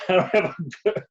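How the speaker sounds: quick words through a laugh. Real, not acted. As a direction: chuckle your way through it. Repeats phrase, then laughs.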